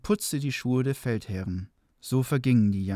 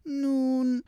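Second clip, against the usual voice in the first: high, slow, silky devil voice; smooth polished devil; 12 semitones higher than usual. Acted. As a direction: sweet-talk him like a sly devil. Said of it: "sagte der Teufel".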